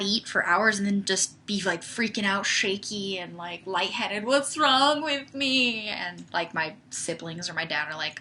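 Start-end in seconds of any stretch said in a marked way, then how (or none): 4.2-5.9 slow, higher mock-distressed whine; exaggerated woozy complaint, stretched and raised; mock wail. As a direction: draw it out in hammed-up self-pity. Acts out her own hungry complaint.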